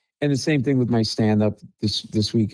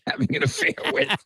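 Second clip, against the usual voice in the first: laughing; 3 dB quieter than usual. Real, not acted. Laughs telling awkward family story.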